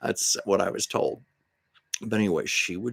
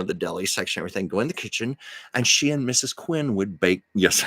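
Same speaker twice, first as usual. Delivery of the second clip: normal talking voice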